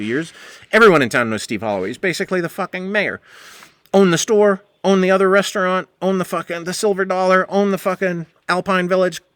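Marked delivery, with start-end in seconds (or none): none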